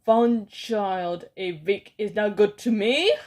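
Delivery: loud contemptuous cry; angry cry. Acted. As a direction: berate the lazy witches. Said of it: "the Grand High Witch cried out".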